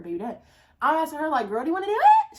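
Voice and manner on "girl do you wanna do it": excited invite. Retold or imitated. Offering shrooms to her friend.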